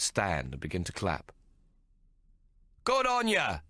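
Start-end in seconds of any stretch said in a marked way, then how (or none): 2.8-3.6 high cheering call from the crowd; cheering shout. Acted. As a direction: shout your approval. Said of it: "someone shouts".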